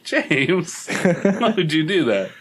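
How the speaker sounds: hammed-up scolding of James; mock scolding. Playfully scolding voiced James.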